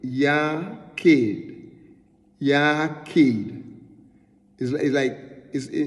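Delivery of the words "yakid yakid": "yakid" said carefully, a hard k; Hebrew pronunciation. Shown as a demonstration. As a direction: repeat "yakid" as YAH-keed, falling at the end. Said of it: "So we pronounce it".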